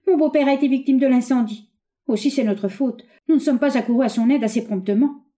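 high-pitched, distressed outburst, easing slightly; alarmed cry. A narrator's reading. Young woman believing her father-in-law burned, alarmed cry. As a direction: exclaim in dismay and self-blame. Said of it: "s'écria-t-elle".

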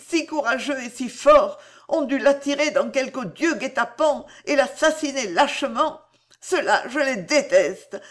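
she spits out her hatred of the assassins; angry. A narrator's reading. Angry girl cursing her beloved's murderers. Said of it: "en répétant ces mots avec colère".

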